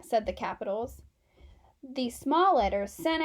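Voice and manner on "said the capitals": usual speaking voice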